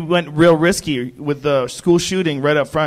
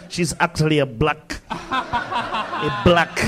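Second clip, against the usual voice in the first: put-on South African accent; much slower than usual. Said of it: "You said that with, like, a South African accent".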